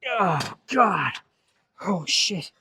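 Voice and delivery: high, agonized cry as the set ends; real muscle-burn groan, pitch falling away; pained exertion. Real, not acted. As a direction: spent and hurting after heavy reps. End of a burning set.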